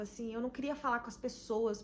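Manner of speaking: usual speaking voice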